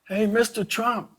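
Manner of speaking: high, stretched call across a crowd. Retold or imitated. Sheriff calling out to candidate Trump from the ramp. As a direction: call his name up to him over the bustle. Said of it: "and I yelled out".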